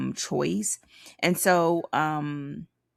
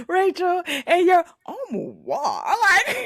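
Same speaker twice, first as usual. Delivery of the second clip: high squealing laugh through words; cracking up; 7 dB louder than usual. Real, not acted. Laughing at friend's armoire joke.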